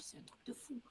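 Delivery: breathless whisper, voice dropped right away; whispering to herself. Retold or imitated. To herself after her late father's message, awed whisper. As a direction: hush it, stunned.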